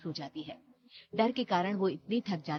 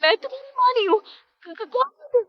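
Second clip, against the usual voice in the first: tearful, very high, stammering panic; terrified; 10 dB louder than usual. Acted. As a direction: cry out in terror at the ghost. Terrified of ghost child. (डर से रोती, हकलाती आवाज़)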